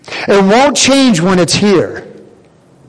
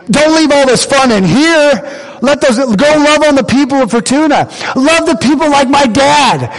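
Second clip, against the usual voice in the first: eager yes; 8 semitones higher than usual. Retold or imitated. Pastor retelling his answer to the leaders, eager and higher. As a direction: fire them up to go out. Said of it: "And I said".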